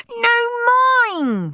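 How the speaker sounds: high wailing cry; screamed. Acted. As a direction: wail it in a tantrum. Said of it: "screamed Steve".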